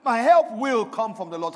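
loud, emphatic declaration; firm affirmation. Shown as a demonstration. Preacher modelling the line for the congregation to repeat, firm affirmation. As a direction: proclaim it with full conviction. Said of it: "say that with an affirmation".

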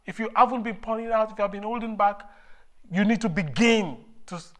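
rising fervent exhortation, building loud; preaching at full force. Acted.

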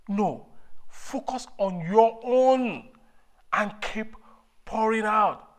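rising fervent exhortation, building loud; preaching at full force. Acted.

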